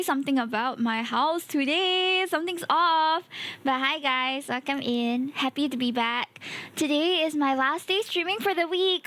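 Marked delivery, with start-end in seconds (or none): none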